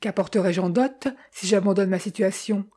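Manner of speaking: plain reading voice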